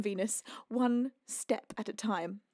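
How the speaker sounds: slow, low and deliberate; slow self-calming. Real, not acted. Streamer talking herself down, slow and deliberate. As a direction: space it out, steadying yourself.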